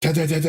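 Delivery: light ta ta ta mimicking keys; keyboard tapping imitation. Mimicking typing to mock filler words, light tapping. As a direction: tap out the sound of a keyboard. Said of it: "it's like somebody doing the keyboard".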